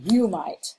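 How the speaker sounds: trochaic "you might", first word stressed. Shown as a demonstration. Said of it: "I'm stressing the first syllable rather than the second one".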